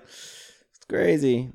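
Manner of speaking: slow, deadpan agreement; slow, flat-voiced husband; understated husband. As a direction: play it cool and slow. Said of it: "He's just just like".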